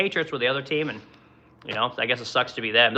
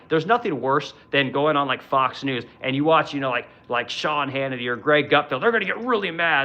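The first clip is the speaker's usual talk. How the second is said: normal talking voice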